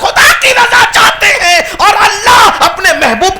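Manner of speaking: high, loud, building sermon cry; fiery preaching. Acted. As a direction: preach it to the rafters, rising each line. Preacher at full force.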